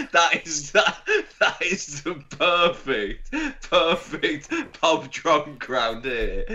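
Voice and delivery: speaking through laughter; real laughter, slow and breaking; words squeezed out while laughing. Real, not acted. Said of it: "I'm crying".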